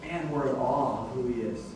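a congregation hushed in awe of Jesus. Retold or imitated. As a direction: breathe it out in quiet amazement. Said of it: "we just go".

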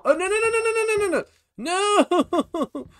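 very high, loud stream of no's; frantic high-pitched protest; panicked squeal. Real, not acted. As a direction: squeal in alarm, ending on a long wail. Game going wrong, panicking.

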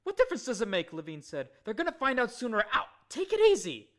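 nervous man talking too fast; agitated Levine. A narrator's reading.

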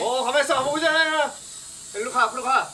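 excited high calling. Real, not acted. Steering the drone by shouting.

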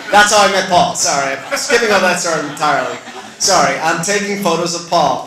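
loud embarrassed laughter through the words; laughing at himself. Real, not acted. Laughing at his own slip.

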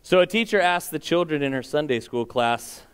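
ordinary talk

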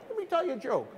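high, eager pitch; eager comedian voice. Retold or imitated. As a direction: jump in eager to tell a joke. Albert eagerly jumps at the mic.

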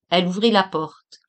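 ordinary delivery

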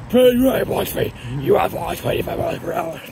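imitating a glitchy app voice; mock robotic glitchy readout. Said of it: "like the glitchy voice".

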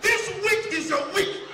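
shouting pastor. Acted. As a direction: declare it at the top of your voice like a pastor. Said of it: "which church when the pastor they sound like this".

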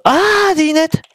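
high-pitched excited "aha"; sudden realization. Real, not acted. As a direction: blurt it out as the answer suddenly dawns.

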